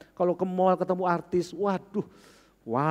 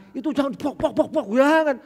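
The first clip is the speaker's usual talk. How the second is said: high, mock-rough slapping at the skin; mock rough rubbing; 11 semitones higher, 7 dB louder than usual. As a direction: act out scrubbing the face roughly, high and loud. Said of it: "Itu kayak saya itu". (meniru menggosok kasar, suara tinggi dan keras)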